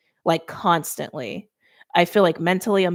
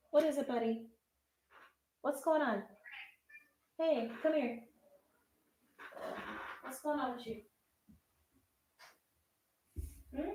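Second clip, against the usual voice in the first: higher, much softer, away from the mic; gentle pet talk; 6 semitones higher, 15 dB quieter than usual. Real, not acted. Reader checks on needy Clyde mid-reading, gentle pet talk. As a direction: coax a pet softly from across the room.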